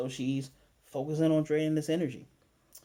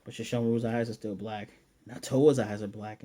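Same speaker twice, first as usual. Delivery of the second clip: ordinary talk, nothing marked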